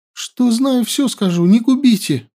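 high, hurried plea for mercy; frightened begging. A narrator's reading. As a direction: beg for your life. Captive begs for life. (испуганная мольба)

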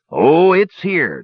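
drawn-out, eager reassurance. A narrator's reading. Dwarf eagerly assuring the brothers, drawn out.

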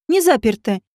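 ordinary delivery, nothing marked

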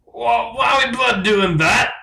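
high hammed-up English bird; unconvincing English accent. Blue questions the rainforest trip, high English accent. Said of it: "very Englishmen".

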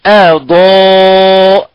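أضاءت drawn out slowly, light hamza before a heavy ضاد; tajweed recitation. Shown as a demonstration. Teacher reciting أضاءت slowly to show the light hamza. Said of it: "مثل ما قلنا هنا".

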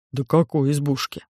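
low, heavy, dismayed giant; low alien voice. A narrator's reading. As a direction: rumble it like a big clumsy alien.

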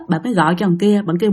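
usual speaking voice

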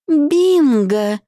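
high, loud gloating shout; triumphant shout. Acted. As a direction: crow it in victory. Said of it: "выкрикнула Маринка".

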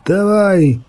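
high, rousing cry; storyteller's high shout of command; barked command. A narrator's reading. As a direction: urge them on with a lifted pitch. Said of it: "гаркнув мишур".

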